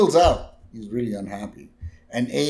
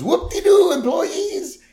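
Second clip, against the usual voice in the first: put-on high giddy old man voice; mock joyful boss on the PA; imitating Burns announcing; Burns announcement; 10 semitones higher than usual. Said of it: "He goes".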